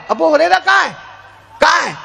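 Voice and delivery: high calling voice, searching. Acted. The Prophet calls for the hiding Abu Hurairah, calling out. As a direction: call out across the gathering.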